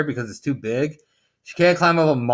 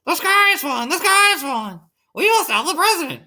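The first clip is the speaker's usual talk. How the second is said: high-pitched, louder panicked chicken cry; clucking hen; 13 semitones higher, 3 dB louder than usual. A narrator's reading. A frantic hen spreading news that the sky is falling, clucking. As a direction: squawk the alarm way up high.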